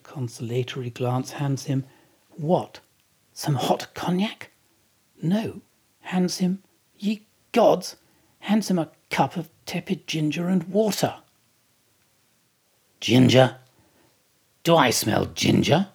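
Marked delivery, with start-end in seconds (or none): 2.4-11.2 higher, incredulous build to the reveal; rising incredulity at a cup of ginger; mock indignation. A narrator's reading. Indignant rhetorical narration. 13.0-15.9 loud suspicious sniffing question. Acted. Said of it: "suspiciously asks Stubb".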